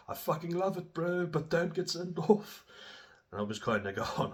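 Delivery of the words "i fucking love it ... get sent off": high, slowing mock coach voice; imitating the coach. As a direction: pitch up and drag out the warning. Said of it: "he was like".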